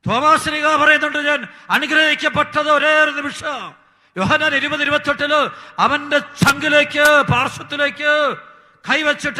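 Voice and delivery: high, loud proclaiming; fervent preaching. Acted. Preacher proclaiming Thomas's blessing to the congregation, fervent and loud. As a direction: cry it out to the whole church.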